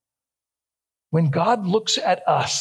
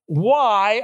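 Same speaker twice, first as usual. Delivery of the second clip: why hit loud and high, then a pause; stress on why; 4 semitones higher, 3 dB louder than usual. Shown as a demonstration. Preacher quoting Gideon, hammering the word why. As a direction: land heavily on why. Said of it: "underline the word why".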